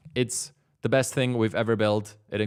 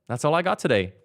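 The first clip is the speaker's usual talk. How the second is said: ordinary talk, nothing marked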